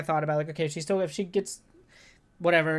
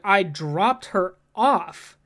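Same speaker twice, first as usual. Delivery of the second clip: insistent; 5 dB louder, much slower than usual. Retold or imitated. Arguing with the nurse.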